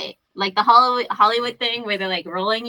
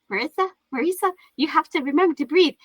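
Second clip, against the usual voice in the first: imitating the midwife; 5 semitones higher than usual. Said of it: "the midwife was all like".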